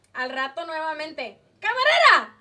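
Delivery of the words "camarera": put-on loud drunken shout; drunk yelling. Drunk man shouting for the waitress again, loud. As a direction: call out across the bar.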